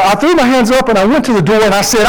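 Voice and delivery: normal talking voice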